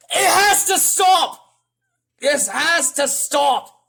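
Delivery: high, loud, slow protest; shouting like another YouTuber. Retold or imitated. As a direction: punch out each word in a yell. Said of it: "I'm sounding like another guy, uh, another YouTuber".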